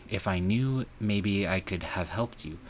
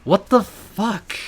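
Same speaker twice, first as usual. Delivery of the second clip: slow, much higher and louder yell; shocked yell; 13 semitones higher, 12 dB louder, slower than usual. Acted. Reacting to the betrayal with a slow shocked yell. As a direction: bellow it out in disbelief.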